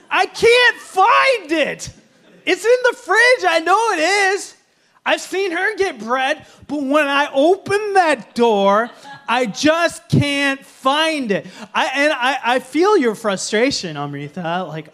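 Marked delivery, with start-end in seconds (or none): 0.1-11.5 higher, louder, slow frustrated whine; exasperated complaint. Retold or imitated. Husband complaining to the congregation about the fridge, higher and exasperated. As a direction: plead that it just isn't there.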